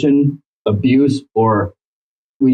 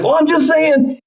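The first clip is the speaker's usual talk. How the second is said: high-pitched playful backpedal; exaggerated innocent deflection; mock innocence; slower than usual. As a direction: brush it off in a high, joking voice. Jokey deflection acted out.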